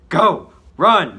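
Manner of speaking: very loud, high shouted warning; yelling. Acted. Max warning the others of the bomb, very loud yell. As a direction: scream at them to flee. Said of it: "Max yells".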